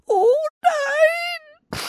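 put-on very high, tearful child-elephant wail; crying little elephant. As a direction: wail it like a small upset elephant. Small elephant cries out in distress.

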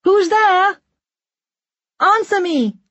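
high-pitched calling out; shouting. A narrator's reading. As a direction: shout for whoever is out there to answer. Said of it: "Lisa shouts".

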